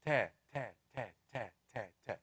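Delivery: "ta" said breathy and soft, air released on each. Shown as a demonstration. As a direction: let air escape on each "ta". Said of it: "there should be air with it".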